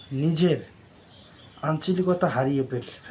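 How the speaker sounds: ordinary talk, nothing marked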